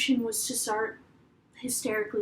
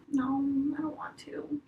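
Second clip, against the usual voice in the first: whispered, reluctant no; unvoiced whispered refusal; hushed refusal; 9 dB quieter than usual. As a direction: breathe the refusal almost silently. Refusing his request quietly.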